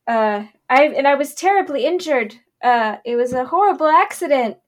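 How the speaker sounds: high, loud swooning damsel; fluttering cries for help. Acted. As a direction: fake a faint with a high cry. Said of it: "practiced, like, fainting move".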